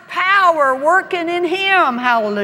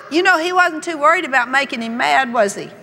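ordinary talk

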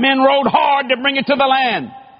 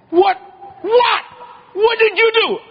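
high, rising outraged what; indignant Moses; 9 semitones higher than usual. Retold or imitated. Moses confronting his brother over the golden calf, outraged. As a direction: demand it high and shocked.

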